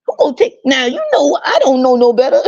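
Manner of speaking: very high, squeaky put-on voice of an elder; mocking relative voice. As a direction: screech the excuse in a mocking pitch. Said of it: "they will still talk like that".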